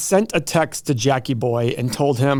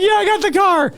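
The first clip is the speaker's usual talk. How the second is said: much higher, triumphant yeah; gleeful cheer; 3 dB louder than usual. Retold or imitated. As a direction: crow the win to Lisa. High-fiving Lisa.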